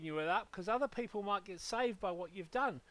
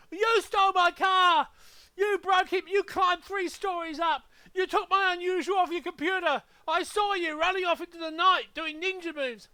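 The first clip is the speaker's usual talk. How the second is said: high, loud ranting shout; hammed-up furious yelling; 8 semitones higher, 8 dB louder than usual. Mock victim shouting at every John Smith. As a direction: scream it like a madman blaming John Smith. Acting crazed accuser yelling.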